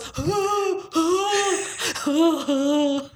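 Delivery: high, whimpering panicky gasps; hammed-up cowardly gasping; Bert Lahr-style gasps. [name] interrupts as the deed is about to be signed, mock panicked gasps. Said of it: "she sounds like Bert Lahr".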